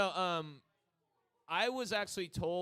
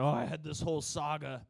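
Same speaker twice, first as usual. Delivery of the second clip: louder, lightly hammed-up complaint; mock lament; 5 dB louder than usual. Bandmate left with unfinished songs, mock lament. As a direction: lightly act out a let-down songwriter.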